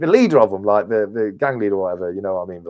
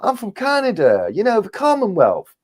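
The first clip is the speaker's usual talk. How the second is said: high, swooping Canadian voice; friendly Canadian stranger; 12 semitones higher, slower than usual. Retold or imitated. Canadian stranger greeting a fellow Commonwealth traveller, high and gushing. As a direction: imitate an excited Canadian, pitched well up. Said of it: "he was a Canadian guy".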